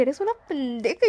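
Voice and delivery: retold friend's insult, chuckling through it; mock insult broken by laughter; laughing insult. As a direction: tease her while you laugh. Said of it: "Mel se empezó a reír de mí".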